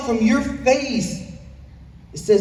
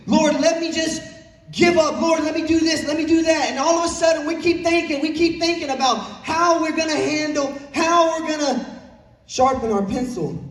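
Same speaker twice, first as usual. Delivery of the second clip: raised, pleading voice begging the Lord; pleading prayer; 5 semitones higher than usual. Acted. Preacher voicing a believer pleading to handle it alone, raised and pleading. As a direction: climb high and press like a desperate prayer.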